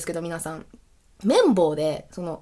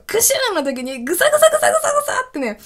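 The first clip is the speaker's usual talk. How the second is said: much higher, sharp stabbing sound effects; mimicked painful sneeze; 14 semitones higher, faster than usual. Telling viewers about a sneeze that stabs the throat, high and piercing. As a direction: jab out each グサ high.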